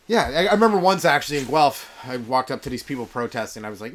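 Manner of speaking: usual speaking voice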